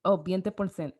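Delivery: ordinary talk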